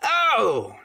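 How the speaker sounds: high, loud startled exclamation; a sudden loud Oh of surprise; quite loud. Acted. Silver spots the squire's letter, loud and startled. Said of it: "said he, quite loud".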